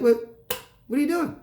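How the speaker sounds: high, baffled what are you doing; incredulous. Retold or imitated. Retelling confronting the friend in the same outfit, incredulous and high. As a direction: pitch it way up in disbelief.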